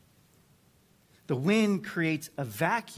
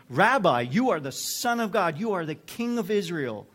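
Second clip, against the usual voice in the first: loud, higher-pitched exclamation of faith; awed proclamation; 4 semitones higher, 6 dB louder than usual. Acted. Nathanael answering Jesus once he feels seen, loud exclaimed confession. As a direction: burst out as the skeptic turned believer.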